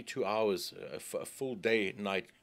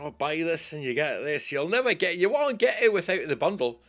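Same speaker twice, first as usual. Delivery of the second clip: loud, high-pitched pushy seller; mocking sales pitch; 7 semitones higher, 6 dB louder than usual. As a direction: imitate the bundle hype, louder and higher. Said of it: "They keep saying".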